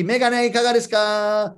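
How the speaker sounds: sing-out street promoter's pitch, held on one note; chanted sales call; tissue hander's call. Acted. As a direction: call it out to passers-by, stretching the end. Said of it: "if it's glasses".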